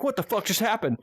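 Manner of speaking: high, bewildered exclamation; bewildered what the fuck. Retold or imitated. Marines running back up the hill, high bewildered outcry.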